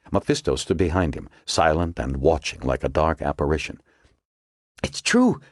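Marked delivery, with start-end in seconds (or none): 4.8-5.4 panting. A narrator's reading. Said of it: "she panted".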